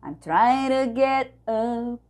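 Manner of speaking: slow demo of the flapped T. Shown as a demonstration. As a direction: tap the T in "get up". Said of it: "esse to também pode ficar r".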